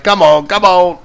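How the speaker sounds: replaying his own eager chant; a keyed-up come on come on; excited. Breaking packs, he relives seeing the colors, eagerly. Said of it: "got all excited".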